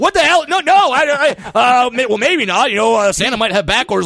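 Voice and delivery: high, loud panicked backpedaling; a father scrambling to take back a promise; frantic high yelping protest; panicked dad. Retold or imitated. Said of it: "The dad starts freaking out".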